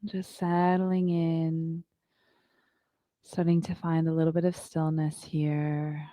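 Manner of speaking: calm, unhurried yoga teacher voice; guided meditation. Acted. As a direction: guide the class into stillness. Leads a yoga breathing meditation.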